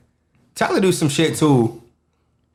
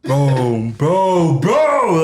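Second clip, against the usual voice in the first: each bro higher and louder; rising bro bro bro; 3 semitones higher, 4 dB louder, much slower than usual. Retold or imitated. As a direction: build the protest up.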